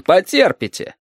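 high-pitched dismissive smirk. A narrator's reading. Captain tells travellers to endure, smirking. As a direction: tell them off with a smirk. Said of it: "ухмыльнулся капитан".

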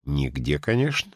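softly enigmatic answer, rising at the end; mysterious reply. A narrator's reading. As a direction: answer quietly, as if keeping a secret. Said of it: "загадочно ответил рыжий". (загадочный ответ)

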